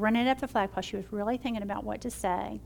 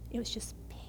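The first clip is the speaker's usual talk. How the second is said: hushed whisper of relief; whispered peace; 10 dB quieter, faster than usual. Real, not acted. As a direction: let the words fall to a whisper. Describing relief after the fear lifted.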